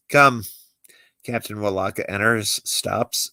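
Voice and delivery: usual speaking voice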